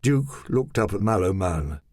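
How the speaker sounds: plain reading voice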